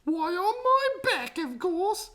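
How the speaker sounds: very high astonished question; surprised. A narrator's reading. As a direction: gasp it out in disbelief. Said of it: "She was so surprised".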